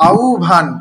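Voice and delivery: আউভান said loudly as "au-bhan"; emphatic teacher's demo of আউভান; au-bhan pronunciation. Shown as a demonstration. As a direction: model আউভান with a clear "u" before "bh". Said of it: "তারপর আউভান লেখা হয় কিভাবে".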